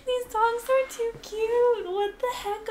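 high squealing delight; cute overload squeal. Real, not acted. Gushing over the dogs, high squeal. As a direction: squeal like it's too cute.

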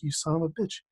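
soft under-the-breath curse; muttered curse. Retold or imitated. Teen realizes Jeff set him up, muttering softly. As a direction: curse the friend quietly to yourself.

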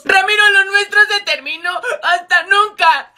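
melodramatic breakup. Acted. Girlfriend dumps Ramiro over the rumour, tearful melodrama. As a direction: end it forever like a soap-opera heroine. Dramatic breakup outburst before teacher.

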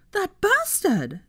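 high, drawn-out cry of outrage; outraged cry. A narrator's reading. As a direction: pitch shoots up, words stretched slowly. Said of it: "Daphne cried and pounded the table".

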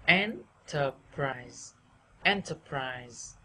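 "enterprise" slow, "en" lifted, the rest dropping; first-syllable stress. Shown as a demonstration. Teacher modelling, "enterprise" slowly stressed on EN. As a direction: raise "en" and let "terprise" fall. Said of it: "đẩy cao trọng âm lên".

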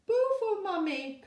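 put-on high, loud babyish coo. A narrator's reading. Baby cheering for Mummy, high cooing voice. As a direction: coo it like a happy toddler. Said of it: "cooed the baby".

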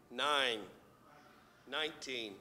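usual speaking voice

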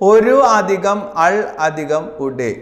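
drawn out word by word; slow dictation. Shown as a demonstration. Teacher to exam students, slowly dictating the answer. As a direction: spell each part out slowly for the class. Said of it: "teacher dictates the correct answer".

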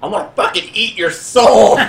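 high, loud, hammed-up screech; vicious threat. Captured woman threatening her captors, vicious screech. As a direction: screech it like a feral captive. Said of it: "she is spitting at you and just vicious".

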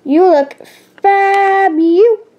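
drawn-out, high fabulous; gushing compliment. Acted. Young reader voicing a friend's compliment, gushing. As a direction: gush it, stretching the last word high and long.

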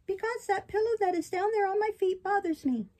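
high, complaining puppet voice; fussy puppet. Acted. Puppet stalling the fairy with excuses, fussy.

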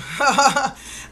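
short real chuckle; amused laugh after a punchline. Real, not acted. Laughs after her own 'boom'.

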